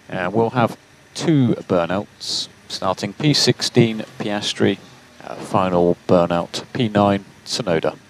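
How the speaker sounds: normal talking voice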